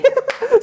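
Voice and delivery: loud high laugh; high burst of laughter. Real, not acted. Laughing at own story.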